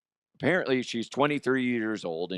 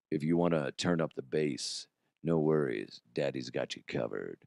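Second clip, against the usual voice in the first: deep voice; 7 semitones lower, 4 dB quieter than usual. Pretend. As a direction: drop into a low bass voice. Deep bass voice for the listeners.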